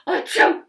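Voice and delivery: high, loud hammed-up achoo; big loud sneeze. Wolf sneezing on the pig's house. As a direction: let the sneeze explode.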